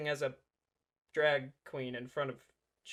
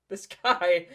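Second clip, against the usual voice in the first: laughing; 8 dB louder than usual. Real, not acted. Laughing mockery at the clip.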